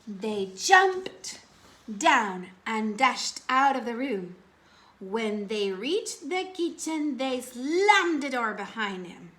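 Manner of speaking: plain reading voice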